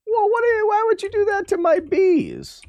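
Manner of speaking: high squeaky mock outrage. Streamer squealing in mock outrage over his bees. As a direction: squeal indignantly about the bees.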